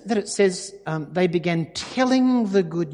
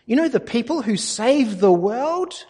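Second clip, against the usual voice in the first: eager opener; 7 semitones higher than usual. Pretend. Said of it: "Why didn't I say to Lisa".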